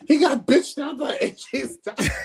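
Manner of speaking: high, loud, cracking up; real hilarity bursting into a shout; laughing shout. Real, not acted. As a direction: blurt it out loudly through laughter. Cracking up at the wrestler's humiliation.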